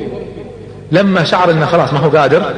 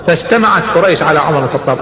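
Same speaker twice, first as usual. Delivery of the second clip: ordinary delivery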